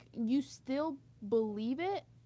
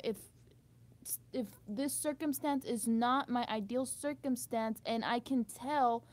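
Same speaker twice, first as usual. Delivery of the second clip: usual speaking voice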